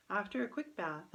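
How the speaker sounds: usual speaking voice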